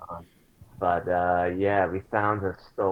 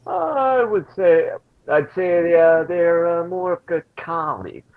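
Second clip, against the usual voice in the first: much higher, louder, slow stalling; nervous hedge; 9 semitones higher, 6 dB louder, slower than usual. Acted. Tal fumbles explaining her friends to Orla, stalling. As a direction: stall awkwardly for an answer.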